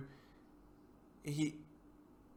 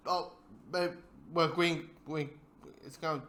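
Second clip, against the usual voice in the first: halting, tripping over the words; mumbling, stumbling; 4 dB quieter than usual. Real, not acted. Speaker losing the Green Goblin line mid-thought, mumbling and stumbling. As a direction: stumble through it with broken restarts. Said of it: "I'm mumbling, or I'm stumbling right now".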